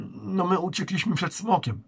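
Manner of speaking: blurted out. Acted. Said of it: "wypalił Oskar".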